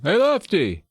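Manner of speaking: high-pitched call for someone; imitated boy calling out; mock yell. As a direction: holler for him without real volume. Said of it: "And Max yells for him".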